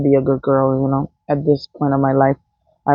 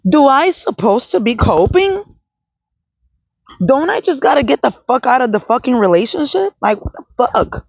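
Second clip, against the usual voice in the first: indignant outburst; 7 semitones higher than usual. Real, not acted. Indignant retort to coping question.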